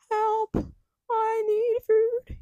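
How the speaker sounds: hammed-up Victorian urchin cry; poor urchin child. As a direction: wail it like a starving chimney boy. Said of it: "poor child in the city".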